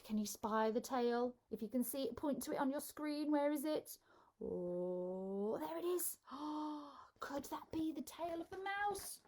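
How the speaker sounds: usual speaking voice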